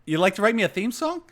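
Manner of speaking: loud, high-pitched incredulous question; delighted surprise. Real, not acted. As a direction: echo the offer back in surprise, rising. Host reacts to unexpected offer.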